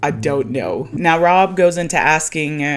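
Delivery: normal talking voice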